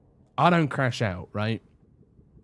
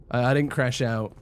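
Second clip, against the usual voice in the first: ordinary talk, nothing marked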